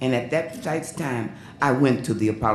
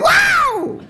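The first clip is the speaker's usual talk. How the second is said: much higher, loud, laughing wow; excited shout; 7 dB louder than usual. Retold or imitated. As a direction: yelp it back with delight. Girl shouts back at singer.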